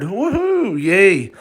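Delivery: high, gleeful whoop; excited cheer. Acted. Bianca cheering because Bernard comes along, excited whoop. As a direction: cheer like she just won.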